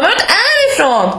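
high-pitched mock exasperation; exasperated colleague. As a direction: imitate a coworker demanding to know, laughing. Colleague demands an answer, acted out.

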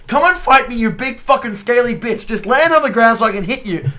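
frustrated yelling. Retold or imitated. Player retells shouting at a Skyrim dragon, mock yell. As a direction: vent at a dragon that won't land. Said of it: "I'm like".

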